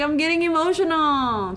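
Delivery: high, loud, hammed-up outburst; mock emotional. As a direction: play at welling up with big feelings. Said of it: "It's like that".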